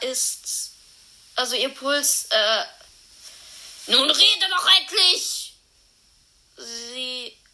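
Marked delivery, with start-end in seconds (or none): 3.9-5.5 higher, louder, exasperated; impatient shout. Acted. Friend snaps at the others to finally say it, loud. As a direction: demand it sharply.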